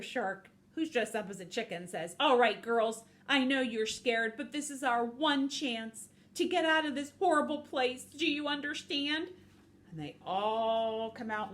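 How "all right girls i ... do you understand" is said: loud, urgent pep talk; acted leader rousing the troops; rallying speech. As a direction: address the flock firmly, pitch lifting as it goes. Shark rallies the scared chickens.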